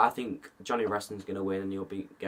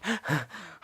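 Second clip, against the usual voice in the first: a small play-acted gasp of fright; mock gasp; 3 semitones higher, 4 dB quieter than usual. Kid vlogger pretending to be scared at night, mock gasp. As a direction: gasp as if something moved in the woods.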